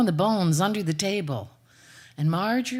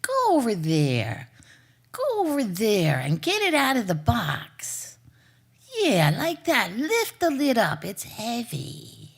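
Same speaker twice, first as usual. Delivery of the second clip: coaxing stepmother; 4 semitones higher, slower than usual. Acted.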